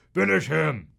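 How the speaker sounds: lightly put-on loud announcer shout; game announcer. Host mimicking Mortal Kombat's announcer, loud fight command. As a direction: call out the finishing command.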